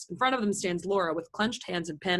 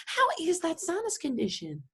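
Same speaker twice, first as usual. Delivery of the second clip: high, softer show of concern; solicitous; 6 semitones higher, 5 dB quieter than usual. Acted. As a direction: inquire sweetly in a raised pitch. Acted sympathetic question on phone.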